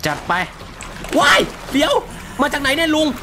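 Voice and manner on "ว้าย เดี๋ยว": startled shriek. Real, not acted. Startled by enemy in game.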